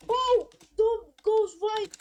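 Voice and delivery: sudden loud, high "Oh!"; excited shout. Real, not acted. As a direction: yell the mistake out. Car goes wide, live call.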